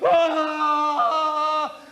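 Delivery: a far-off terrible scream; mock scream from far away; distant scream. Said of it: "this terrible screaming away in the distance".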